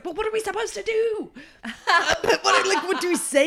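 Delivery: mock panic, voice jumping high; panicked question. Gallery worker asking a colleague, excited panic. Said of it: "And I was like".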